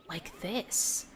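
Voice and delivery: soft, breathy mock yell; whisper yelling. Shown as a demonstration. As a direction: push a whisper like a shout. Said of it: "that half whisper yell".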